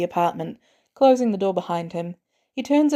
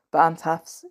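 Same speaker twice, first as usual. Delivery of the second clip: usual speaking voice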